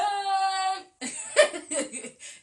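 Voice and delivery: big burst of laughter; loud high laugh. Real, not acted. Laughs at own street voice.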